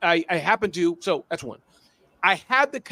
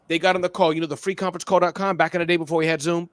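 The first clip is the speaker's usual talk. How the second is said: ordinary talk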